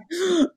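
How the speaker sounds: gasp. Retold or imitated. Shocked realisation mid-meeting.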